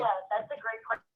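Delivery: faint echo of the line; distant hall reverb echo. Real, not acted. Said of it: "sorry for the reverb".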